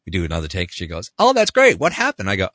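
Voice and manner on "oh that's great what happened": high, loud opening, acted delight; delighted surprise. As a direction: imitate a client suddenly pleased. Acting client's delighted surprise.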